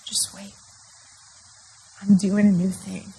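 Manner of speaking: very soft, unhurried assurance; God's quiet reply. Retold or imitated. God answering her pleading prayer, hushed and patient. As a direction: drop right down to a hushed reassurance. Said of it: "And God, again".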